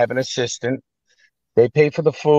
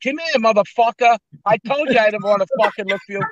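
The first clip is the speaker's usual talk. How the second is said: mock mobster threat; 10 semitones higher than usual. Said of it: "I go".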